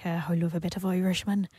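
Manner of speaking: light mock Irish accent; put-on Irish accent. Host admits her taste for Irishmen, put-on Irish accent. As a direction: slip into a playful Irish lilt. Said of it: "pretend that I'm Irish".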